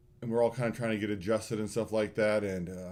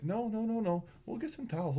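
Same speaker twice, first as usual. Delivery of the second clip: high, soft brushing-off; imitating an easygoing "don't worry"; retold reassurance; 9 semitones higher, 3 dB quieter than usual. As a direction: wave it off lightly, pitched up. Retold man waving off help.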